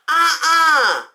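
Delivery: flat refusal from a bold friend; loud sassy uh-uh; loud opinionated friend. Retold or imitated. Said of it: "very loud and opinionated".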